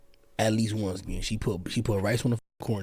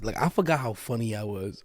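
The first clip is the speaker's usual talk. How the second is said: ordinary delivery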